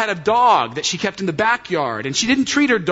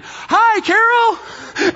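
high, loud cheerful yell across the street; loud friendly shout; 4 dB louder, much slower than usual. Retold or imitated. Brian running past shouts a greeting to Carol. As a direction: call out her name at full volume. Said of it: "shouts out, like, as loud as he can".

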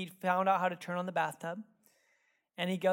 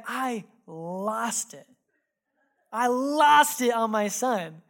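LOST drawn out slow and loud; slow, loud stress on "lost"; emphatic confession; 3 semitones higher, 8 dB louder, slower than usual. Real, not acted. Confessing his outburst emphatically.

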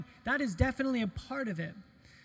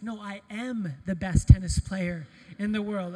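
P=A; insistent kid; 9 dB louder than usual. Retold or imitated. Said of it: "he looks at me, he's like".

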